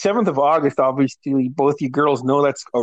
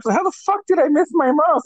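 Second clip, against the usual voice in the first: incredulous; 12 semitones higher than usual. Retold or imitated. Retold incredulity.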